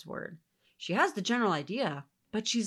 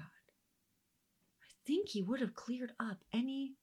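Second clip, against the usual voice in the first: whisper; 4 semitones higher, 3 dB quieter, slower than usual. Real, not acted. Teacher musing aside.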